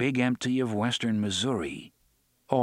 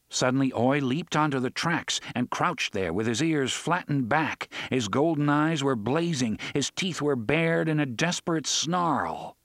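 ordinary delivery

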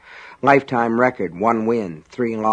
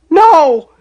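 high, loud scream of refusal; child's panicked shriek; terrified scream; 12 dB louder than usual. A narrator's reading. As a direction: scream it in horror. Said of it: "Sean screamed".

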